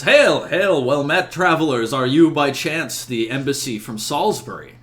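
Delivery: hearty in-character call to newcomers. Acted. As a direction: hail travelers across a distance. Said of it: "he calls out to you".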